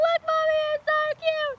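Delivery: put-on high, bright little-girl voice; delighted kid voice. Showing mom the baby snake, high delighted child voice. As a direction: sound like a thrilled child showing off a pet. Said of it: "I'm like".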